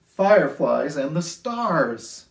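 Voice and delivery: sing-song rhyming storybook read; lilting picture-book voice; reading to children. A narrator's reading. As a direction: read it gently to a class of little kids.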